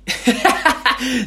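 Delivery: high, burst of giggling; embarrassed laugh. Real, not acted. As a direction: laugh at your own slip-up. Laughs at own blunder.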